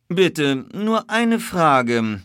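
ingratiating plea for a moment; polite request. A narrator's reading. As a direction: ask courteously, stretching the words. Detective politely approaches stablehand.

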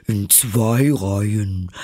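drawn-out call of the Mock Turtle; slow, eager creature call. A narrator's reading. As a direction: call it out slowly, in character. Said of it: "rief die falsche Schildkröte".

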